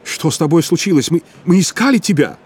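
agitated appeal to a lost friend. A narrator's reading. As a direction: blurt it out high and urgent. Shocked friend on the phone.

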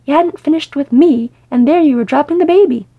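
loud, high-pitched accusation; indignant scolding. A narrator's reading. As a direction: berate him over an old grievance. Mother scolding over dropped baby.

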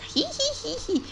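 high soft mock giggle; tiny tittering hee-hee, very high; a playful demo of a giggle; little giggle. Shown as a demonstration. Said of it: "como risita".

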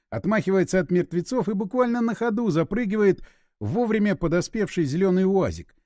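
usual speaking voice

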